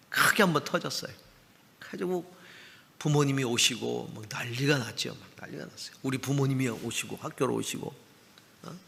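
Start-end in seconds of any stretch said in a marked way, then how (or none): 5.2-6.0 a voiceless murmur under the story; whispered aside. Real, not acted. Telling the congregation about the uproar, whispered. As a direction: breathe it out as a hushed aside.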